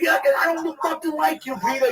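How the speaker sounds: high-pitched, indignant protest; worked up and complaining; retold anger. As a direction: throw it back at him, aggrieved. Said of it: "I was so fucking angry".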